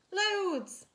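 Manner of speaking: high, loud cheeky sibling shout. Acted. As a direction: shout it like a gang of kids. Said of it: "said her brothers and sisters".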